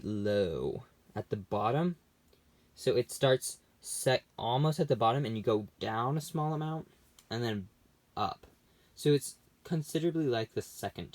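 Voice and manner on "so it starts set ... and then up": ordinary talk, nothing marked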